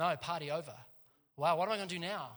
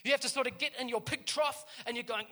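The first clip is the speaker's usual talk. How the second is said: ordinary talk, nothing marked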